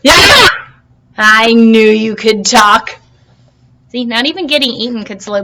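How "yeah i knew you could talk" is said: triumphant. Acted. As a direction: crow in triumph. Triumph as mussel talks.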